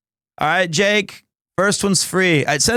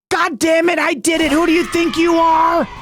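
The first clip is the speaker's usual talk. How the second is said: high, shouted triumphant bowler's roar; Pete Weber yell; 10 semitones higher than usual. Retold or imitated. Streamer quoting Pete Weber's famous line, shouting. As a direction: roar it like you just bowled the winning strike. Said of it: "He has another line".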